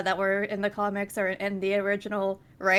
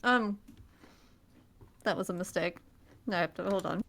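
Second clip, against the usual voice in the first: talking through a mouthful of food; mouth full. Real, not acted. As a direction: chew and talk at once. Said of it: "talking with my mouth full is weird".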